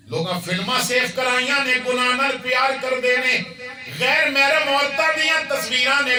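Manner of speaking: loud, high, fervent rebuke; preaching at full force. Acted. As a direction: thunder it at the congregation. Sermon climax on loving sin. (بلند آواز وچ جوشیلا وعظ)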